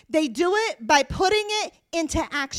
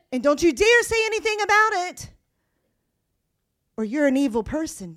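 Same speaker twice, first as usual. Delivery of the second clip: mock scolding; 5 dB louder, faster than usual. Mocking intimidating culture.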